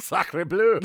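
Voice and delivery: loud hammy French exclamation; French accent. Pretend. Mock Frenchman after Napoleon jokes.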